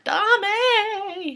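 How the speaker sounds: high wailing dame; child's scream. Retold or imitated. Teacher imitates toddlers crying dame, high and wailing. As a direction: cry dame like a scared toddler. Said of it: "learned the word".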